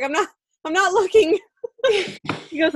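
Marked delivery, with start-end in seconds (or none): 0.0-1.4 high, loud, mock-panicked denial; flustered protest. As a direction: retell it squealing with embarrassment. Retold flustered reply to him.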